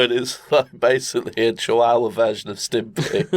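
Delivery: ordinary delivery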